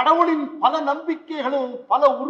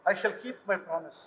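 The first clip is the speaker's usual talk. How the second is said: solemn vow; 7 semitones lower, 8 dB quieter, much slower than usual. Acted. Priest solemnly vows to the mother.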